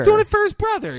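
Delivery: high, loud mock protest; hammed-up emphatic defence; acted heated insistence. Friends argue back at Susan.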